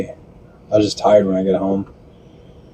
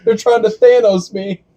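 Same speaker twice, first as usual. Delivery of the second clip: mock panic; 10 semitones higher, slower than usual. Voicing a shrunken-hero panic.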